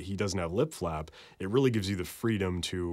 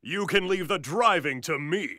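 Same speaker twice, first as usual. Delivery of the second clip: booth take; 9 semitones higher, 3 dB louder than usual. Acted.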